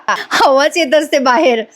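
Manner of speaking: slow words breaking into laughter; laughing. Real, not acted. Telling viewers about the air, laughing slowly. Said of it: "खूप हसू येत होतं".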